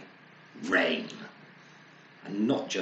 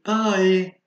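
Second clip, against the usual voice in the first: high, loud cheery farewell; cheery bye; 11 semitones higher, 12 dB louder than usual. Acted. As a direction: sing out goodbye on the way to work. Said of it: "with a cheery".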